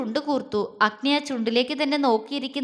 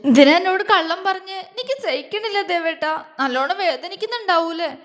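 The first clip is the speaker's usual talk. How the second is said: higher, teary plea to her husband; a touch of tearful heartache; teary pleading; 5 semitones higher, 3 dB louder than usual. A narrator's reading. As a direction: ask it with tears welling, pitched up. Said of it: "കണ്ണു നിറച്ചുകൊണ്ട് ചോദിച്ചു".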